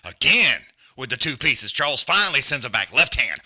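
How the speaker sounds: loud ringside play-by-play, building with each punch; live fight commentary. Acted. Calling the boxing match round by round, loud and excited. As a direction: call every punch like a hyped announcer.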